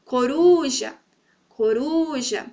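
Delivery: Coruja, middle syllable held long; teacher's demo of coRUja, RU lengthened; stress on RU. Shown as a demonstration. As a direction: stretch the RU in coruja. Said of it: "Essa sílaba, então, do meio".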